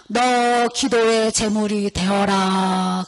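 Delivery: very slow, grand male voice, drawn out; voice of 하나님. Retold or imitated. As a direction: intone it like a majestic divine command. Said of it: "남자 목소리로 크게 웅장하게 들려요".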